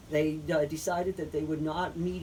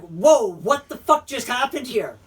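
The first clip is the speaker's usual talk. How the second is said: shocked outburst; 6 semitones higher, 11 dB louder than usual. Retold or imitated. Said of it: "they're like".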